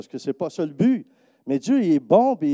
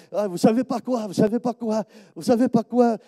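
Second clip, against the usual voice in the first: high, excited repeated teaser; can't hold in the news; eager woman teasing her news; bursting with news; 8 semitones higher than usual. Retold or imitated.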